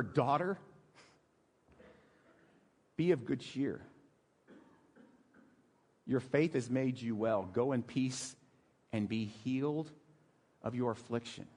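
soft, low and tender, with long pauses; tender, moved. Acted. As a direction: comfort her gently, moved nearly to tears. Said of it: "it actually made me cry".

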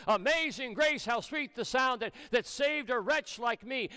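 "Amazing" and "wretch" punched hard, high voice; stress on WRETCH, AMAZING. Shown as a demonstration. Preacher quoting the hymn to his congregation, stressing "Amazing" and "wretch". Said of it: "Emphasis on wretch and therefore emphasis on amazing".

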